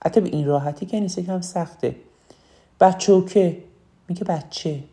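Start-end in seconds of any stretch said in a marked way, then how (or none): 2.8-3.6 "بچوکه" with a Shirazi -oke ending; Shirazi accent. Shown as a demonstration. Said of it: "به عنوان مثال یه شیرازی".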